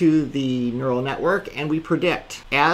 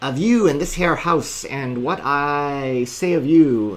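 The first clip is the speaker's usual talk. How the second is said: slow, hammed-up pirate voice; pirate accent; slower than usual. Shown as a demonstration. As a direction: growl it out like an old sea dog. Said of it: "I'm not really good at speaking in a pirate accent".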